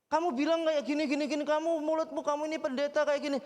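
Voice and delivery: high, fast imitated accusation; angry accusation. Caller accusing the pastor on the phone, high mock angry. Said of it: "dia dengan marah".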